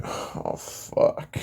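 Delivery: unvoiced-sounding whispered curse of dismay; dismayed under the breath. Son realizing Zeus overheard him, whispered dismay. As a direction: let it out as a hushed, voiceless curse. Caught by his dad, retold.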